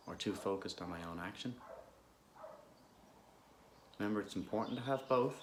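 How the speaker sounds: calm, unhurried guidance with long pauses; guided meditation. Real, not acted. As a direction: gently guide listeners into stillness.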